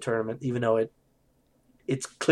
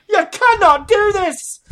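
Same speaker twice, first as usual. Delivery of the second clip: high, loud Scottish yell; hammed-up Scottish outcry; screamed Scottish accent; 11 dB louder, slower than usual. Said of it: "in a Scottish accent, but just scream".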